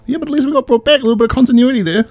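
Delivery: high, quick mock reply; lightly put-on parents defending themselves; comic character voice. Voicing parents in recap.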